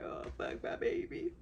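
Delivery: high, breathless whisper; whispered dread. Retold or imitated. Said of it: "I'm like".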